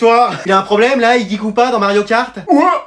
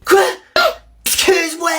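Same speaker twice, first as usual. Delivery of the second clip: shocked excuse-moi; 8 semitones higher than usual. Acted. Outraged at hike wake-up.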